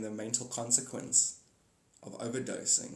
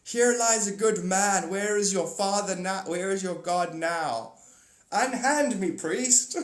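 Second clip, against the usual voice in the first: hammed-up TV scene; 6 semitones higher, 6 dB louder, slower than usual. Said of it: "he's like".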